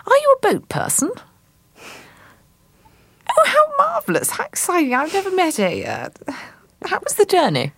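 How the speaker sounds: very high, gushing lady voice; patronising hostess. Acted. Marjorie gushing over the artist, very high and overdone. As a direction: gush at him in a high fluting voice. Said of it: "she pauses for a while and she says".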